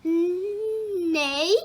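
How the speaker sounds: whinny like a horse; horse neigh. Acted. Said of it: "said the horse".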